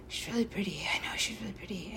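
soft admiring whisper. Real, not acted. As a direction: murmur it in awe, quickly at the end.

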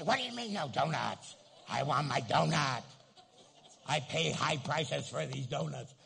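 put-on loud, childish donut tantrum; a churchgoer loudly demanding his donut; mock angry demand.

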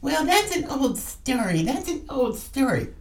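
dismissive mock voice, quiet; mocking imitation. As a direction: parrot the critics with disdain. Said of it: "it's like".

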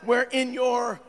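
loud, ringing sermon delivery; full-force preaching. Acted. Pastor preaching loudly to the congregation. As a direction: preach it out to the whole church.

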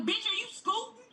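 high mocking retort; sassy comeback. Retold comeback to heckler.

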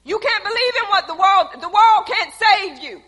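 loud, rising sermon push; preaching at full force. Acted. Preacher warning the church, loud and urgent. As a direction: press the point on the congregation.

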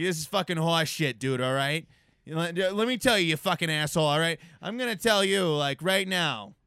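loud Boston accent rant. Shown as a demonstration. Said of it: "throw your face into it".

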